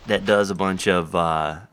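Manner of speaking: ordinary delivery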